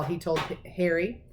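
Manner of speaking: ordinary delivery, nothing marked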